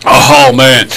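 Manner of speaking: ordinary talk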